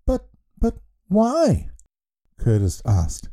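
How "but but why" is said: put-on stammering boy. Curtis stammers at strange news.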